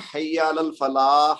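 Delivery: "حی علی الفلاح" with long final laa into h; call-to-prayer wording. Shown as a demonstration. Teacher quotes the azan, drawn-out final laah. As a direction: stretch the last syllable of "حی علی الفلاح". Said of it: "وہ تو ویسے ہی ہا ہے".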